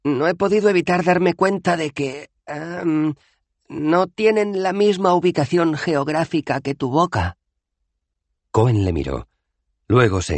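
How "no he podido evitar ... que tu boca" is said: explica con tacto, mucho más agudo; Dos Flores cauteloso. Acted. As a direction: Dos Flores señala los dientes de Cohen. (cortés y cauteloso, muy agudo)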